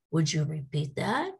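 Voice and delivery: normal talking voice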